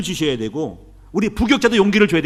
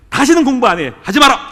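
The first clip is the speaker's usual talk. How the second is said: louder, higher flat refusal; imitating wife's refusal; 6 semitones higher, 9 dB louder than usual. Pastor imitating wife refusing more study, loud. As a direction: belt out her vow to never study again. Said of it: "이제 하는 얘기가".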